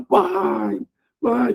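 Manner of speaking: a high, imitated wail of a crying girl calling her dad; mock-sobbing child; crying daughter. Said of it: "Tava chorando aos prantos".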